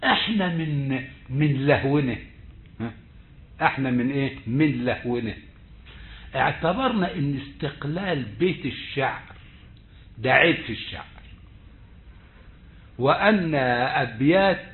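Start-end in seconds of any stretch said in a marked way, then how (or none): none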